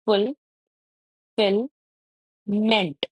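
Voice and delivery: "फुल, फिल, meant" as three isolated beats, long pauses between; syllable by syllable. Shown as a demonstration. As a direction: sound out "फुल", "फिल", "meant" one at a time. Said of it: "इसमें three". ("फुल, फिल, मेंट" हर हिस्सा अलग, रुक-रुककर)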